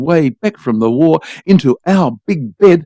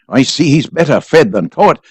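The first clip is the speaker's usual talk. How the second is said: plain reading voice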